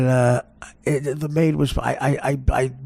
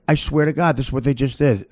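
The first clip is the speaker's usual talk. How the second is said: ordinary delivery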